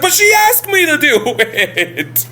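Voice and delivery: Larry protesting to the angry guests; whiny protest. Retold or imitated. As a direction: overdo the indignant self-defence.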